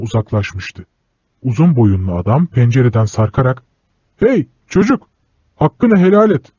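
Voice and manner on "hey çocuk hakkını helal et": calling shout. Acted. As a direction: shout it back as he leans out. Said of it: "diye bağırdı".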